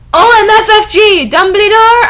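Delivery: high, loud shrieked OMFFG; mock shriek. Hearing Dumbledore's name, a hammed-up giddy shriek. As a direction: scream it in giddy shock. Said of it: "I asked quietly".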